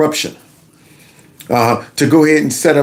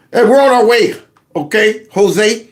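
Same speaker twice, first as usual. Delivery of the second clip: calling out; 5 semitones higher than usual. Acted. Calling out to ICE.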